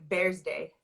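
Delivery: "Bears Day" for birthday, no TH; mother's pronunciation. Retold or imitated. Explaining mother's Farsi accent, "Bears Day" shown slowly. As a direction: say "Bears Day" with a Z-like sound replacing TH. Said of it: "So she would say".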